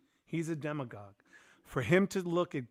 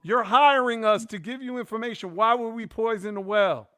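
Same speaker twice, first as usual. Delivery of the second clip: raised, exasperated voice pushing back; indignant protest; 6 semitones higher, 7 dB louder than usual. Retold or imitated. Consultant protesting to the campaign that fired him, exasperated and raised. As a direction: make them see you were only helping.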